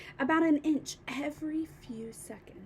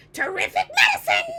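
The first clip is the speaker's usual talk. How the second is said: very high, loud crone's shriek; ecstatic cry; 9 dB louder, faster than usual. A narrator's reading. Grandma to George after the medicine, shrieking. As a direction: shriek with delight. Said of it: "she cried".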